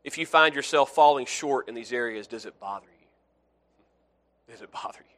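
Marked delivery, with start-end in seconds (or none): 2.3-4.5 drawn out very slowly, much softer; soft searching question. Shown as a demonstration. Preacher probing the congregation's conscience, slow and soft. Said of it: "repeated softly as a probe". 4.5-5.2 breathy whisper, almost silent; whispered question. Shown as a demonstration. Preacher pressing the question home in a whisper. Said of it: "whispered repeat of the question".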